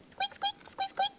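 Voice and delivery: tiny, very high squeaks; soft, high-pitched pretend squeaking; mouse squeaking. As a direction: imitate a little toy mouse. Voices a toy mouse.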